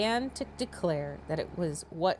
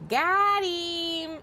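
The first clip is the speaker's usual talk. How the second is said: gleeful; 9 semitones higher than usual. Real, not acted. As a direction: shout it in triumph. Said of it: "reader's own gleeful reaction".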